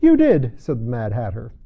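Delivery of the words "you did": loud retort. Acted. Hatter retorts.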